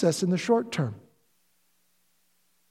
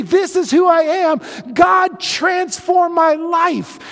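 slow, shouted testimony, higher and louder; bold declaration; 10 semitones higher, 8 dB louder, slower than usual. Retold or imitated. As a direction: proclaim it to the whole church. Quoting his own bold confession.

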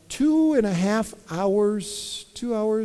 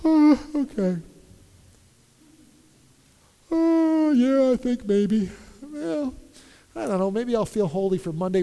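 halfhearted believer; 4 dB quieter than usual. Pretend. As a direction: shrug it off lazily. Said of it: "by hoping and just".

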